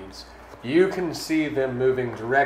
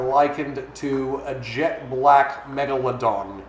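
usual speaking voice

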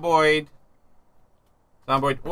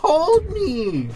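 mock scared; 12 semitones higher, 4 dB louder than usual. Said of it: "I was scared!".

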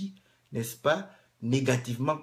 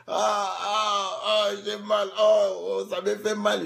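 mock pain cries; 7 semitones higher, 4 dB louder than usual. Imitating cries while claiming muteness.

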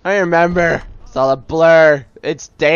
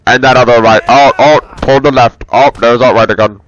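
loud, close-up mock fight commentary; booming play-by-play, badly faked; terrible commentator; 3 semitones lower, 9 dB louder than usual. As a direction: call the fight like a hammy amateur commentator. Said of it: "like, terrible commentary".

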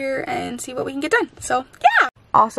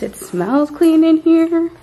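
ordinary delivery

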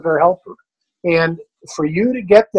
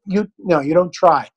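normal talking voice

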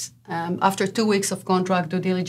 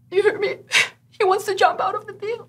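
much higher, rushed and panicked; frantic lender; 13 semitones higher, faster than usual. Retold or imitated. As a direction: mimic the lender's panic, half laughing. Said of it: "her voice was".

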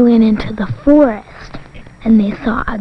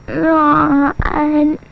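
higher, drawn-out pretend yawn; imitated yawn; 5 semitones higher than usual. Child copies the sleepy frog's sound, mock yawning. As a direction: stretch it into a big mock yawn. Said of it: "then they sounded like this".

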